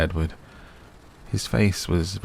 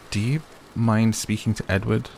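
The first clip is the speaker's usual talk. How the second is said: higher, hesitant question; asked apprehensively; 5 semitones higher than usual. A narrator's reading. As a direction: ask carefully, bracing for his answer. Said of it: "I asked apprehensively".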